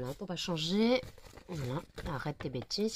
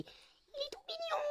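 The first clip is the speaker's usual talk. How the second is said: much higher, slower and softer, gushing over cuteness; cutesy baby voice; 9 dB quieter, much slower than usual. Pretend. An owner gushes to her viewers about her cute chihuahua in a much higher baby voice. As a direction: coo it like talking to a puppy.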